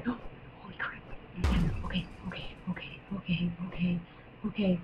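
breathless awed whisper. Real, not acted. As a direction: hush it out, stunned. Gasp then awed whisper.

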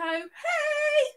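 high, slow drawn-out hey; excited greeting. Real, not acted. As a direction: sing out a happy hello. Greeting viewers in chat.

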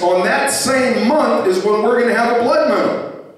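high-pitched, driving sermon delivery; building preaching. Real, not acted. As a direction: preach it with rising urgency. Preacher building at full force.

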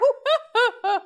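bright high laugh at her own slip; a loud real giggle breaking out; burst of laughter. Real, not acted. Laughing at calling the pug a cow.

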